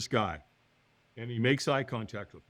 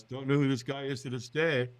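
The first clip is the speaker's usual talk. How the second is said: normal talking voice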